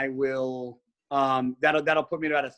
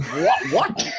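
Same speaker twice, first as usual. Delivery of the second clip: mock disbelief; 4 dB louder than usual. Acting shocked reply to retold remark.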